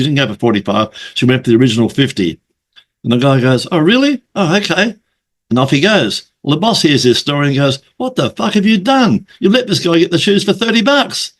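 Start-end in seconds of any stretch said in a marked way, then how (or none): 3.7-4.9 higher-pitched, mock surprised customer; lightly acted surprise, pitched up; mildly surprised. Customer voiced accepting surprise offer. 8.0-11.3 high-pitched, incredulous mock outrage; acted indignation, pitched well up; outraged boss. Boss scolding employee in story.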